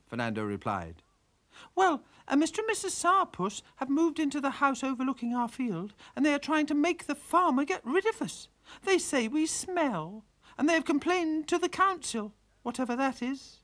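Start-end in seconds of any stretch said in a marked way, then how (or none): 1.8-13.6 high, worried little pig voice; fragile pig. Acted. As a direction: fret it out like a fussed sow. Pig tells her trouble.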